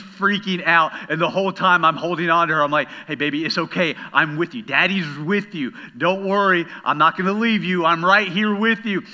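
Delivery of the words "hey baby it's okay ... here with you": put-on louder, higher calls of comfort to a scared child; dad reassuring his daughter on a dark ride, raised comforting voice; calling comfort over the ride's din; reassuring over the noise. Dad over roaring scary ride.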